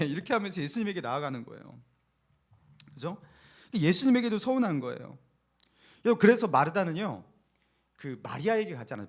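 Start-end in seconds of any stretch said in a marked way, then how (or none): none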